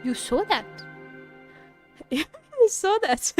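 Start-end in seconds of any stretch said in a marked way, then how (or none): 2.1-3.3 higher-pitched, slow question through laughter; amused disbelief. Real, not acted. Streamer reacting to a lucky game moment, laughing. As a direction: laugh as you ask it.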